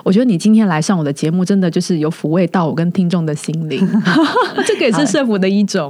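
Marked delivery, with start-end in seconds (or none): 3.8-4.6 short laugh. Real, not acted. Host laughs during the thank-you.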